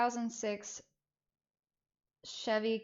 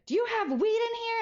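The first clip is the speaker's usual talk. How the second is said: much higher, loud pointed question; mom demanding; 5 dB louder than usual. Retold or imitated. Mom asking teens for weed, high loud demand. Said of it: "And she's like".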